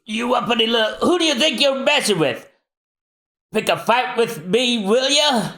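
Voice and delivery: high, brash Frankie voice. Acted. Frankie suspects Zombie's men at door, brash.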